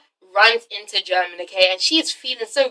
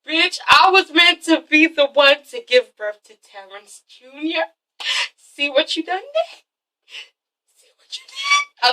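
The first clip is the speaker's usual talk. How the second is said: slow, hammed-up tearful wail; badly faked heartbroken sobbing; mock crying; 5 semitones higher, slower than usual. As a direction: whine it out, rising to a squeak at the end. Acts out Yelena's upset reaction.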